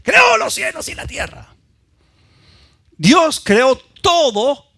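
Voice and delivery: high, loud preaching shout; preaching at full force. Acted. Preacher proclaims creation to the church, shouting high. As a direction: cry it out to the congregation.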